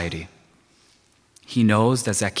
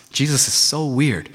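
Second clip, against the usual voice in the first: usual speaking voice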